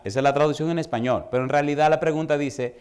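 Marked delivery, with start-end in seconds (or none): none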